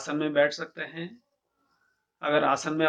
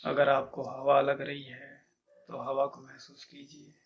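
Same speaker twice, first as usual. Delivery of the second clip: measured meditation guide, long pauses between instructions; guided meditation; 4 dB quieter than usual. Acted. Teacher leading listeners to feel each body part, calm meditation guidance. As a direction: guide listeners calmly inward, growing softer as it goes.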